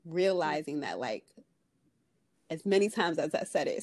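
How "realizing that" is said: ordinary delivery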